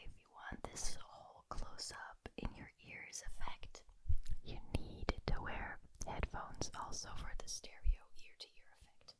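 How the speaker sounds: usual speaking voice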